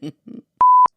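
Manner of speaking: high loud burst of laughter; shrieking laugh. Real, not acted. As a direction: squeal with laughter.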